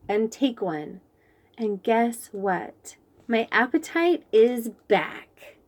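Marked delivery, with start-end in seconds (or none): none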